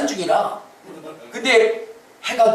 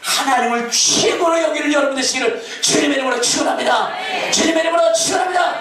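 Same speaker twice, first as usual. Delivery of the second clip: preaching at full force; 9 semitones higher than usual. Real, not acted. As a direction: proclaim the blessing over the people.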